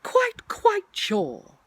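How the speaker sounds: slow, high, loud assurance; insistent. Acted. Pooh assuring Rabbit who he is, insistent. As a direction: stretch out each word firmly.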